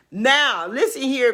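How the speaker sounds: ordinary delivery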